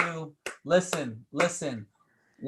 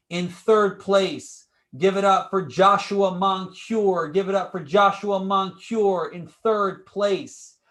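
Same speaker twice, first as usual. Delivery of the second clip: loud, stretched award announcement; hype announcer; 3 semitones higher, 5 dB louder, slower than usual. Acted. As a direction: call out the placing like a game-show host. Host announces third place.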